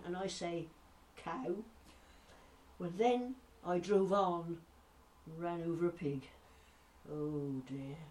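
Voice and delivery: Norfolk storyteller winding down, deadpan punchline; old Mother Miller. Acted. Norfolk gossip signing off to the listeners, chatty and easy. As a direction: finish the yarn in broad Norfolk. A bit more posh than I do.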